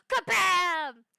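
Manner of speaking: loud, booming impact word; explosive exclamation. Acted. Not wanting to scare members, explosive exclamation. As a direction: slam it out suddenly and loud. Acts out a sudden membership announcement.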